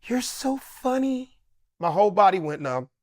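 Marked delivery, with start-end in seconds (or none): 0.0-1.4 high, breathy-soft, slow imitation of Michael; slow, high, soft [name] impression. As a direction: hush it out slowly, starstruck and gentle. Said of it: "looks me dead in my face and goes".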